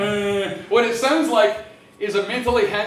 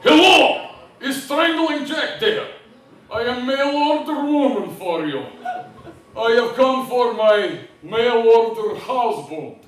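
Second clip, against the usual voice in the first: mock Russian bride; 2 semitones higher, 4 dB louder, slower than usual. Comic plays his Russian bride arriving, hammed-up Russian accent. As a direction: play a huge Russian bride at the door in a broad accent. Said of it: "Ordered myself a mail order bride from Russia".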